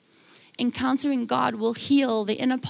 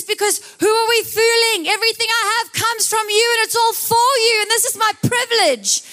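King David's voice, pitched higher and louder; a king offering everything to God, lifted and fervent; higher, louder voice for King David's grateful prayer; 10 semitones higher, 5 dB louder than usual. Acted. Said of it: "This is King David talking".

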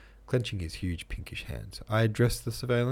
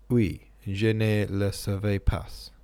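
ordinary delivery, nothing marked

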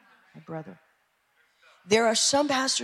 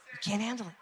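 quiet; 10 dB quieter, much faster than usual. Real, not acted. Pastor answers, suddenly quiet.

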